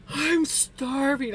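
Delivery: slow whiny mom voice; imitating mom. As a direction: drag it out like a hungry mom. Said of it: "She's like".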